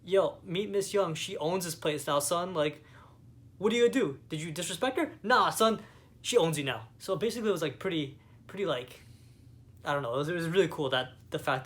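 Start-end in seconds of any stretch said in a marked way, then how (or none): 0.0-6.9 mock hotel owner. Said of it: "He's like".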